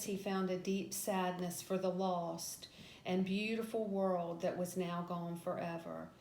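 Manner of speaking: plain reading voice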